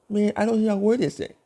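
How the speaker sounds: high-pitched mock complaint; baffled listener. Listener complaining about the fast talker, baffled.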